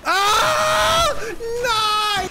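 high panicked cry; sudden high scream then cry of no; startled scream. Real, not acted. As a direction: shriek as if jumped by an enemy.

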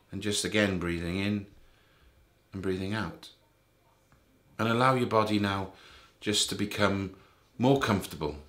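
soft, low voice with long pauses between phrases; guided meditation. Real, not acted. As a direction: ease the listener gently towards sleep. Leads a bedtime meditation.

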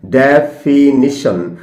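ডেফিনিশন said slowly and carefully; ডেফিনিশন drawn out deliberately, the correct way; correct pronunciation. Shown as a demonstration. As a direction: spell out ডেফিনিশন syllable by syllable. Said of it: "ডেফিনিশন কিন্তু নয়".

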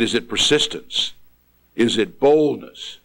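ordinary talk

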